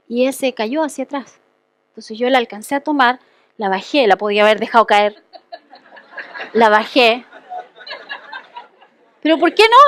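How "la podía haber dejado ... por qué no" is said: laughing through the words at her own temptation. Real, not acted. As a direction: chuckle as you confess it.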